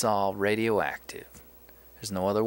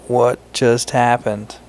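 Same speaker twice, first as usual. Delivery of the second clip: startled, much louder; loud surprised shout; 2 semitones higher, 12 dB louder than usual. Real, not acted. Player startled when the scene freezes, shouting loud.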